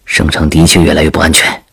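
low, hushed confidential voice; worried veteran soldier. Acted. Soldier crouched with the princess, low hushed objection. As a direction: keep it low so others don't overhear.

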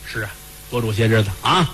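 low agreement breaking into laughter; chuckling old master. Acted. Master echoes keeper with laugh.

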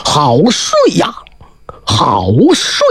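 slow, drowsy sigh while stretching; waking and stretching. Acted. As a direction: yawn the words out, just waking. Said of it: "他伸了个懒腰".

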